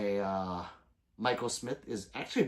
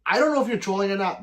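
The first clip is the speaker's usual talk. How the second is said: emphatic; 9 semitones higher, 10 dB louder, faster than usual. Real, not acted. Addressing chat viewer emphatically.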